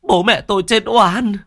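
high, loud tearful lament; sobbing cry. Acted. Son crying over his wronged parents, loud sobbing lament. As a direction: cry out the injustice through tears. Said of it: "mếu máo".

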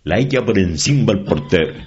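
high, loud play-by-play mimic; hamming up the village radio commentator; sports reporter. Village reporter sending his match tape, excited commentary. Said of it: "de repente decía".

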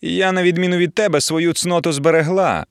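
high, self-righteous boast; haughty taunt. A narrator's reading. Rival boasting to silent Emiliia, haughty taunt. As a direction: put her down from on high.

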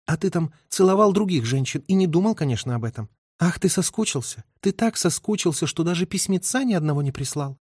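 high-pitched, reproachful woman's voice. Acted. Acted quarrel in story.

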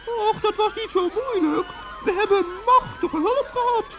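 very high, chirpy little gnome; a tiny forest gnome, all innocence; high gnome voice teasing the witch. Acted.

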